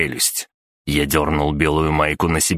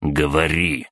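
plain reading voice

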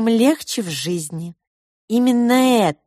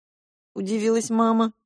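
plain reading voice

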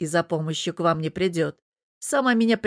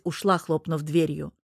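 ordinary delivery, nothing marked

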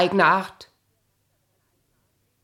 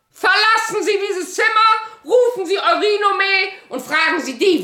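commanding; 9 semitones higher than usual. Acted. Ordering him out of the room.

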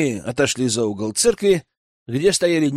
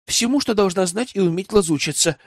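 put-on higher girl's voice, matter-of-fact; Веслава explaining; 6 semitones higher than usual. Young spy tells elder what she was taught, explaining. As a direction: answer plainly in a raised, girlish register.

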